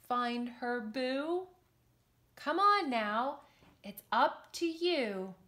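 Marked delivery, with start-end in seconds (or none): none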